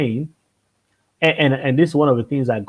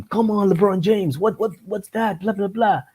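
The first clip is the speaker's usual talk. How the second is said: higher, hammed-up sports-fan shouting at the game; mock husband yelling at TV; 9 semitones higher than usual. Wife mimics husband yelling at basketball on TV, pitched-up mock shout. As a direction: imitate a husband cheering from the sofa, pitched up. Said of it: "your husband is just shouting".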